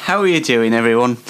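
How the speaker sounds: ordinary talk, nothing marked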